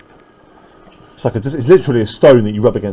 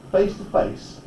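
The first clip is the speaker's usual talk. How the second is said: whispered phrase; 5 semitones higher, 12 dB quieter, slower than usual. Shown as a demonstration. Whispered emphasis.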